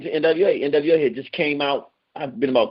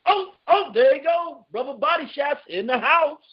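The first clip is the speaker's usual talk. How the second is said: excited welcome; 13 semitones higher than usual. Real, not acted. Radio host welcoming Brother Body Shots to the show, excited and high. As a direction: voice jumps high as the host cheers the guest in. Host hypes arriving guest.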